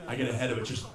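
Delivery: ordinary talk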